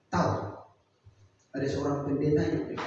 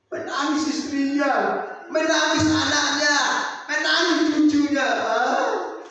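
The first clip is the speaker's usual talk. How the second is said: building preaching; 13 semitones higher, 9 dB louder, slower than usual. Acted. Preacher builds the weeping list.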